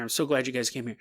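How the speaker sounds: the guest's words echoed back quietly. Acted. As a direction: repeat it again under your breath. Echoes the guest's line softer.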